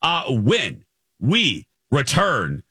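big upbeat radio host tease; radio contest promo. Acted. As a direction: pitch up high, sell the break. Radio tease before break.

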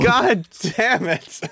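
slow groan through laughter; laughing exasperation. Real, not acted. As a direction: laugh it out in defeat. Laughing at losing the argument.

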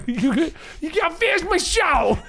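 high whiny fanboy voice; internet voice. Pretend. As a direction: whine like an angry online fan. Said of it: "That's [name]'s internet voice".

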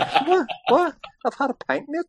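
high squeak of mock outrage; indignant high-pitched protest; shocked disbelief. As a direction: act stunned at the bill. Shocked reaction to splitting the bill.

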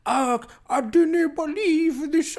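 high, loud, drawn-out mock Scottish; hammed-up imitation of a fake Scottish accent; bad Scottish accent. Scot mocking an English woman's attempt, exaggerated Scottish accent. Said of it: "Here, here's my Scottish accent".

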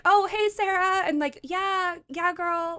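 high, breezy mock-casual greeting; mock casual cool. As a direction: play it like old pals with a star. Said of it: "And I was like".

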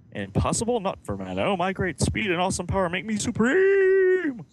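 high, loud hammed-up gloating; over-the-top supervillain boast; boastful villain. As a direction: crow it like a cartoon villain.

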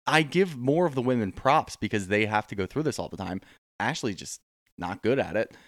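usual speaking voice

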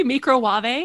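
"Microwave" as mee-cro-wah-vay, a mock English posh lilt; microwave, said her way. Host retelling Nigella's odd word, mock mee-cro-wah-vay. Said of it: "What did she say?".

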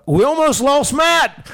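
high, drawn-out punchline; Bradshaw. Retold or imitated. Bradshaw correcting the boss after the fight, high drawn-out imitation. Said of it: "the way he said it and the delivery".

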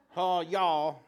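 "ha, y'all" with a southern twang. Shown as a demonstration. Pastor mocks his own Hebrew, southern twang. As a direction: drawl "ha, y'all" like a Texan. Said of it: "getting a southern twang going here".

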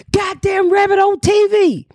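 high, drawn-out exclamation; astonished hollering. Retold or imitated. Neighbors realizing it was her on the show, astonished hollering. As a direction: holler it in amazed recognition.

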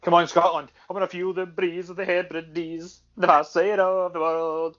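put-on slow, drawn-out Highland Scottish accent; hammed-up Scottish accent. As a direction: put on a big rallying Highland Scots brogue. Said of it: "put on a Scottish accent".

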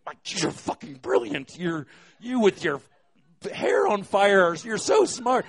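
high, loud gushing praise, rising to a shout; manic high excitement. Retold or imitated. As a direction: gush at her like she just saved your life, yelling by the end. Drugged teller thanks bartender.

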